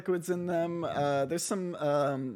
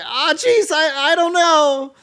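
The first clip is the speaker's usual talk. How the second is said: put-on much higher, louder, slow fretful boy; flustered Mortimer; 12 semitones higher, 11 dB louder, slower than usual. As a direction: fluster and falter. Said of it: "Mortimer's gonna say".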